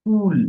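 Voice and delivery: কুল with a long, high, loud u; lengthened vowel. Shown as a demonstration. Teacher giving an example word, stretched out. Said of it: "যেমন ধরি আমরা যদি বলি".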